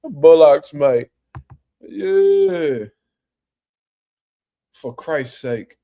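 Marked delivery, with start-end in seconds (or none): 0.0-1.1 louder, hammed-up British accent; loud mock British accent. Retelling Eddie Hearn's swear to the hosts, loud mock British accent. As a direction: bark it like a cheeky British bloke. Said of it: "He definitely said bullocks".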